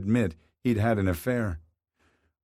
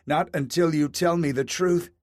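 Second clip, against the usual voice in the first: higher, mild narrated shout; wife shouting at her husband over the affair; 7 semitones higher than usual. A narrator's reading. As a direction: push back with a raised voice. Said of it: "his wife shouted".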